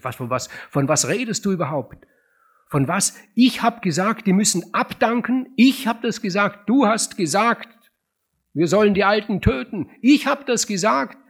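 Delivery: high, loud, disbelieving protest; baffled outrage. Acted. Edgar protesting to his brother, loud and high in shock. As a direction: fling back the accusation in disbelief.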